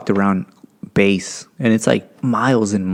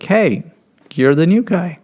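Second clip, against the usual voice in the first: high-pitched imitation of a friendly hello; greeting a newcomer; 9 semitones higher than usual. Coworkers to the new airman at door, greeting a newcomer. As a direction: pitch it up in recognition.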